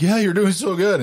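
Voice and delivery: high-pitched mock praise; encouraging parent. Parent to a kid who thinks they're playing, falsely encouraging. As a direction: humour a small child in a high, cheering voice.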